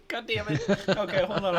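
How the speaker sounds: laughing, gasping for breath. Real, not acted. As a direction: catch your breath between laughs. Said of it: "I gotta take a breath".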